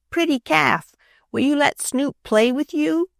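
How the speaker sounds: put-on high, sweet little boy's coaxing. A narrator's reading. Small boy coaxing the calf, high and sweet. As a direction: coax the calf like a small child.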